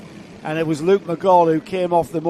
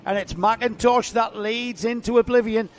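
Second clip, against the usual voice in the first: race commentary; 4 semitones higher, 3 dB louder than usual. Acted. Live play-by-play of the race.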